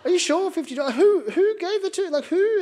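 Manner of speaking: can't believe what you're hearing; dad questioning son about the fifty dollars, shocked; incredulous. Retold or imitated.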